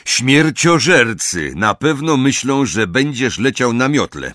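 brief the team firmly; a hint of a gruff commander; stern veteran leader. Moody briefs Harry.

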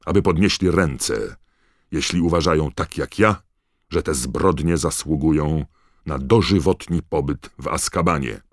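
loud, stern call for a verdict; forceful, merciless declamation; roaring. A narrator's reading. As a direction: thunder it to the whole court. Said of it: "ryknął pan Crouch".

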